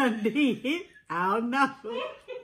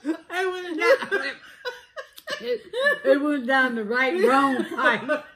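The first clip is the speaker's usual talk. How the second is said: laughing mimicry; 3 semitones higher, 3 dB louder than usual. Real, not acted. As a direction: repeat her while cracking up. Repeating her, laughing.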